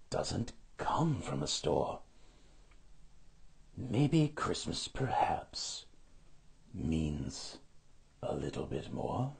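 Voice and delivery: low, soft, slow dawning thought; almost whispering, wonder creeping in; hushed realization. A narrator's reading. The Grinch thinks it through alone, hushed and slow. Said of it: "he thought".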